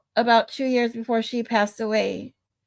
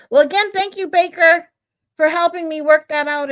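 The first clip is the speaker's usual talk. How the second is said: excited thanks; 6 semitones higher, 5 dB louder than usual. Real, not acted.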